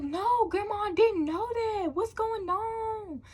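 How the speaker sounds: very high, loud, drawn-out innocence; playing clueless. Child denying to grandma about stolen car, playing clueless. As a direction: feign total cluelessness in a high, slow whine. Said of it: "Just playing stupid and clueless".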